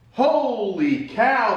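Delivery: slow, strained exclamation; carrying heavy load. Real, not acted. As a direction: gasp it out after heaving. After heavy lift.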